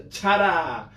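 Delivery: loud showy ta-da; magic words called out. Shown as a demonstration. Magician teaching kids, calling the magic words loudly. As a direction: call it out like a stage magician. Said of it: "say the magic words".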